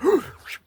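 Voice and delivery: high, loud sharp mock gasp; a sudden shocked intake of breath. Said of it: "she went".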